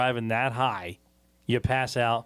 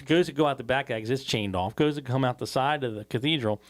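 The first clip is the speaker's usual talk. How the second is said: ordinary talk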